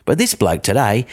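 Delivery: ordinary talk, nothing marked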